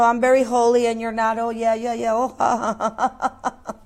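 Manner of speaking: lightly voiced self-righteous Pharisee chuckling; smug Pharisee. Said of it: "you know".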